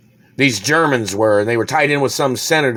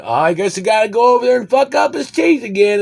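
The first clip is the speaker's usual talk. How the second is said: much higher, put-on sneering voice; mocking official; 13 semitones higher, 4 dB louder than usual. Imitating the German official who threatens him, sneering put-on voice. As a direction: mimic the official with a jeering lilt. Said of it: "He's going".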